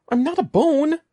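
very high, loud frantic cry. A narrator's reading. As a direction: cry out in total panic. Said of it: "Evan cried frantically".

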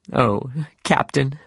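a slow, pained plea; cried out. Acted. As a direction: call to the captain with a touch of grief. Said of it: "Starbuck cried".

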